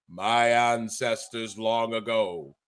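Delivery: low, slow, portentous king voice; hammed-up regal proclamation; grand Egyptian king. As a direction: intone it slowly like an ancient ruler. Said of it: "from Vernon Somoza to the Egyptian king Xerxes".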